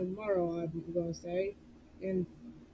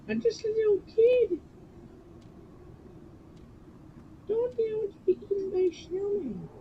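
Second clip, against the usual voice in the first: high-pitched pleading; 9 semitones higher than usual. Real, not acted. Pleading as scared little kid.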